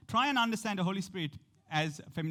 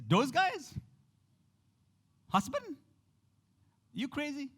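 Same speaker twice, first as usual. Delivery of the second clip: much higher, scoffing woman's voice; incredulous woman; 9 semitones higher than usual. Retold or imitated. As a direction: play the woman laughing off the question.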